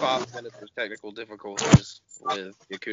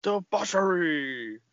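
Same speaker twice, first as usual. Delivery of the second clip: gleeful; 8 semitones higher, 3 dB louder, much slower than usual. Pretend. Gleeful echo of the word debauchery.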